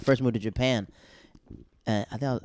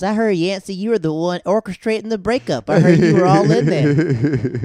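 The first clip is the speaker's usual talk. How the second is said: higher, louder accusing mother, slowing at the end; upset mom; 6 semitones higher, 9 dB louder than usual. Retold or imitated. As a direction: play the angry mama on the phone. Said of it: "She goes".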